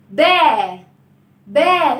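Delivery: با said loud with the mouth wide open; open ba sound. Shown as a demonstration. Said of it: "نفتح الفم".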